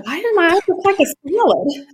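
high puzzled question; baffled. Retold or imitated. Passenger puzzling over the app's compliment, high and baffled. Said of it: "I'm sitting there like".

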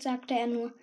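ordinary delivery, nothing marked

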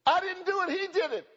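high, loud, panicky denial; high protest. Retold or imitated. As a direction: squeal out the excuse like a caught kid. Preacher voiced protesting innocence.

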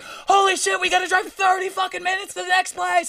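very high mock-panicked shout; freaked out. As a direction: imitate a friend losing it. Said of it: "freaked out and was like".

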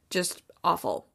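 ordinary talk, nothing marked

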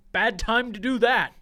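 loud scolding retort; imitated annoyed dad. Father tells son he slept at a bad time, loudly annoyed. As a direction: cut him down with it, irritated.

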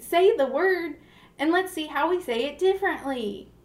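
put-on high, lilting Southern accent. Describing TikTok word challenges, slipping into a Southern accent. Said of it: "I had a Southern accent there".

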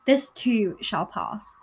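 slow, deliberate "This too shall pass" with a clear "too"; careful correction. Real, not acted. Vlogger re-saying the quote carefully after tripping. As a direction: correct the slip, spacing each word of "This too shall pass". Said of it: "this, I cannot speak".

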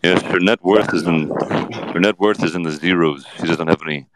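low, slow, halting drawl; Johnny Depp. Acted. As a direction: draw it out low, with pauses. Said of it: "Johnny Depp is on the show".

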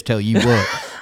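lightly mimicked Southern twang; mock the coach's country accent. Co-host teasing the coach impression, light mock Southern accent. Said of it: "anyone in, uh, Las Vegas coaching over there sounds like this".

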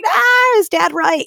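high, loud squeak of self-doubt; doubting baby of family. Retold or imitated. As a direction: sound like the little kid unsure of herself. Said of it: "I'm the baby, so I was like".

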